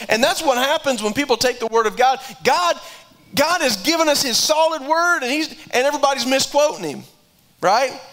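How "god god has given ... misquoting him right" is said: rising preaching fervor. Real, not acted. Preacher building to a climax.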